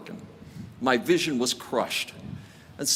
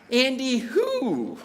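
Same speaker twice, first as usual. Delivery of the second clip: slow, much higher "Andy who?" in disbelief; incredulous; 10 semitones higher, much slower than usual. Retold or imitated. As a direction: stretch it out, not knowing who's calling. Disbelieving reply to caller.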